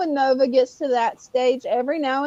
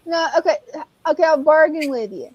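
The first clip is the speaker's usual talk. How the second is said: ordinary delivery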